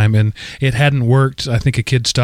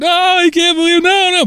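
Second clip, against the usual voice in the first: put-on much higher, a child wailing at the screen; father imitating his daughter's distress, much higher; little girl dismayed. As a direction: cry out like a three-year-old watching a crab. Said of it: "she'd start".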